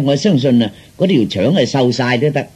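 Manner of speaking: ordinary delivery, nothing marked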